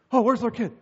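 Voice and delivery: lightly played startled dad; a touch of mild alarm. Dad hearing "daddy" at the park, mildly startled.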